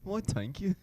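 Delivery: high, put-on British Isles accent; mock accent from the British Isles. Joins in the accent game.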